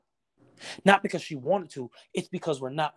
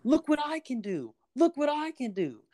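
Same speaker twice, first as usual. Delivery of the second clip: put-on high, eager little kid showing off; excited child voice; 10 semitones higher than usual. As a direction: play a child begging parents to watch, much higher. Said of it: "All you hear is".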